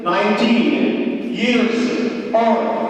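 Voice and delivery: very slow, each word stretched; teacher drawing out a model answer; slow, drawn out. Shown as a demonstration. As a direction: spell it out word by word for the class. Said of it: "What is it?".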